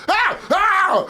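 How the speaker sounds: high, loud screams of fright; re-enacted scream of panic; terrified yell. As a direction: yell out as if startled by intruders. Screaming at intruders in retold story.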